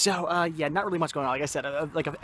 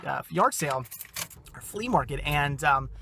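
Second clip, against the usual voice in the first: ordinary delivery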